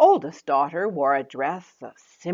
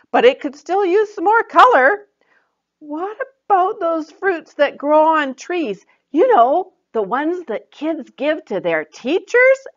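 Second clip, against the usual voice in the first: much higher, louder teasing clue; Joy hinting; 11 semitones higher, 7 dB louder than usual. Acted. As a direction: tease out the answer. Joy hints again.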